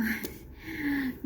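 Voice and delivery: soft high giggle; a quiet laugh at herself; a little embarrassed giggle. Real, not acted. Laughing at own old vlogs.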